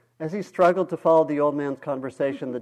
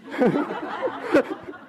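breathy laugh; 13 semitones higher than usual. Real, not acted. Reader laughs.

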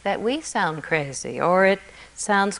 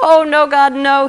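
higher, louder eager cry; hopeful shout; 7 semitones higher, 9 dB louder than usual. A narrator's reading. Atheist calls back to God, hopeful shout. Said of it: "he shouted out, more hopeful".

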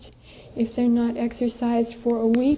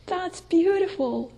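admiring gush; 6 semitones higher than usual. Retold or imitated. People admiring someone they love.

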